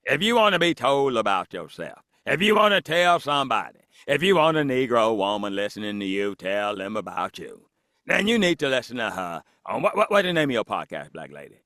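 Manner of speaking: cartoon curmudgeon giving a plug; rolling character voice, sinking at phrase ends; Uncle Ruckus. Guest plugs the host's podcast, in cartoon character voice. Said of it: "Uncle Ruckus, no relation".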